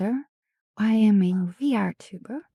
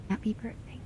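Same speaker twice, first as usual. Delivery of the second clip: very soft, almost whispered birthday wish; hushed repeat of the wish; 10 dB quieter, faster than usual. Real, not acted. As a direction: say it barely above a breath.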